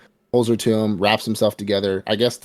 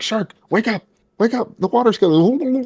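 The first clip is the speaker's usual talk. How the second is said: high-pitched pleading wake up; high urgent call; 13 semitones higher, 3 dB louder than usual. Retold or imitated. Denji shaking his friend awake, high pleading. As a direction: call him awake in a squeaky voice.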